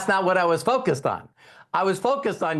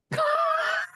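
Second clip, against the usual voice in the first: explosive laugh, much higher; big shouted laugh. Real, not acted. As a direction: whoop with laughter at the punchline. Audience reaction to his own story.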